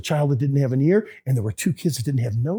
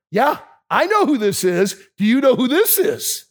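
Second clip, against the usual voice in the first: teasing friend; 7 semitones higher than usual. Retold or imitated. Old friend teasing on phone.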